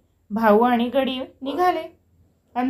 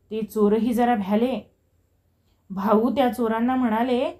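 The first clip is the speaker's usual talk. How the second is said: plain reading voice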